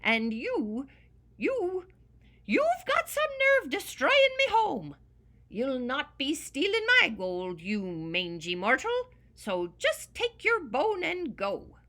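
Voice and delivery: high, loud sputtering outrage; angry leprechaun tirade. Acted. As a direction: build from sputter to furious scolding. Leprechaun scolding the dog.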